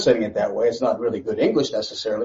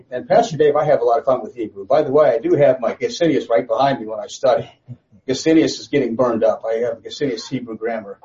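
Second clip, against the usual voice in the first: usual speaking voice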